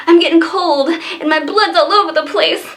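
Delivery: high hammed-up dying lines, laughing through; mock a melodramatic death scene, fast at the end; dying girl. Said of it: "a girl that's dying".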